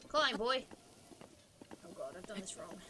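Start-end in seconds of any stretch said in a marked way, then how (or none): 1.8-2.8 breathless dismayed whisper. Real, not acted. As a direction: whisper it in horror.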